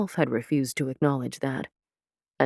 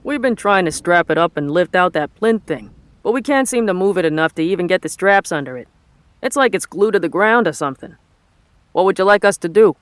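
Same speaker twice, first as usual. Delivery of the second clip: loud, raised Boston working man's voice; thick Boston accent; 4 semitones higher, 8 dB louder than usual. A narrator's reading. Foreman asks his boss about a stuck plinth, thick Boston accent. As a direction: report the problem in thick Boston. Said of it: "in a thick Boston accent".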